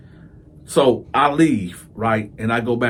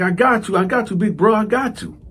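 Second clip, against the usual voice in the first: eager reassurance; 8 semitones higher than usual. Retold or imitated. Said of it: "He like".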